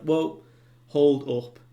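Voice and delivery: retelling a character's loud hold-up; sudden halt. Retold or imitated. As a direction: throw a hand up and stop them loudly. Stopping the crew short.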